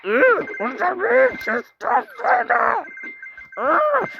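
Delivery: high slow put-on voice; put-on character voice. Child playing a character asks Sister Fredda. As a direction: speak as a squeaky pretend character.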